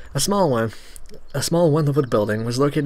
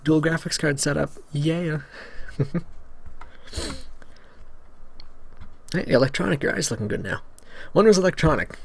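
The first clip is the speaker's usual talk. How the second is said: ordinary talk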